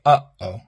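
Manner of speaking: "uh-oh" with the air cut sharply in the middle; glottal stop demo. Shown as a demonstration. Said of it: "the same sound that you make when you say".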